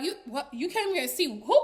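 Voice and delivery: stammered mock disbelief, rising on who; double take. Diamond hearing who the visit is for, mock double take. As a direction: stop short, then query it quickly. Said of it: "almost had to do a double take".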